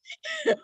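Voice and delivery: sudden high squeal of a laugh; quick high giggle. Real, not acted. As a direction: burst out laughing at the picture. Laughing at bad-eyebrow scenario.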